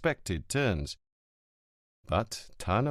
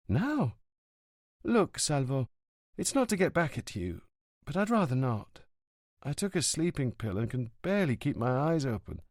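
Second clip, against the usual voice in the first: put-on girlfriend's voice; 4 semitones higher than usual. A narrator's reading. Livia on the phone to Montalbano, apologetic. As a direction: make up gently with him.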